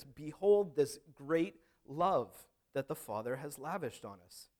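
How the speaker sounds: solemn slow scripture reading; grand proclamation. Shown as a demonstration. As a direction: read it as a grand announcement. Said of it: "as they're reading this".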